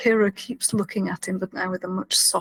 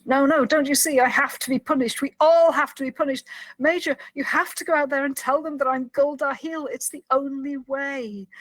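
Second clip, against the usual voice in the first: higher, insistent imitated plea; pleading; 5 semitones higher, 4 dB louder than usual. Prisoner begging Kira to let him be punished, pleading. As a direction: act out an urgent appeal, emphatic.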